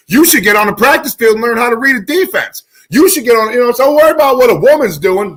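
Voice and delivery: ranting at Cam Newton; heated rant. Real, not acted. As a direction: tear into him.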